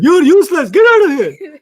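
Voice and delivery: hammed-up angry manager firing him; imitated boss yelling. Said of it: "he'd fire me like".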